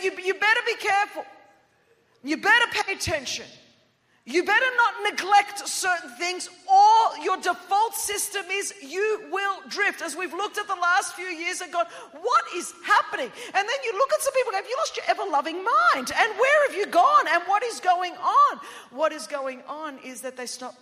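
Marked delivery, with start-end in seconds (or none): 0.0-10.0 high, loud preacher's warning; urgent warning. Retold or imitated. Preacher voices the writer's urgent warning. 12.1-13.3 mock disbelief. Acting out a bewildered reaction. 14.6-18.6 high, loud mock outrage; exasperated disbelief. Acted outburst at baffling people.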